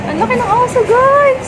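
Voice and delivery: thrilled at a huge dog; excited. Real, not acted. Sees a big dog.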